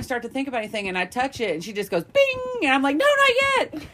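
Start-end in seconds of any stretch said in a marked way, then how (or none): 2.0-2.4 a sudden, very high, loud "Bing!" chime; ping it out like a cartoon bell; cartoon ding of a toy going off. Toy making her come instantly. 3.0-3.9 much higher, louder panicked protest; comic alarm, begging it to wait; mock panic. Pleading with her body, too soon.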